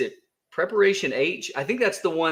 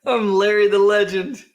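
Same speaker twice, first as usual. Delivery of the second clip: Larry the Legend; 7 semitones higher, 7 dB louder than usual. Pretend. Self-announcing nickname boastfully.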